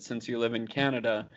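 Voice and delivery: ordinary delivery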